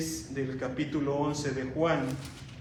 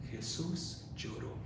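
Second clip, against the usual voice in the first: soft, low, almost breathed; hushed; 5 semitones lower, 11 dB quieter than usual. Acted. Preacher to the congregation on Jesus weeping, hushed almost breathed. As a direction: let it fall to near silence.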